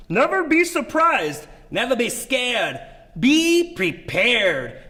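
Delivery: slow, hammed-up stage voice; theatrical declaiming. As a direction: declaim it grandly, drawing out each word. Hammy delivery after accent advice.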